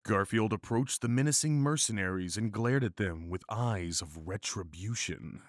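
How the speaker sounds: ordinary delivery, nothing marked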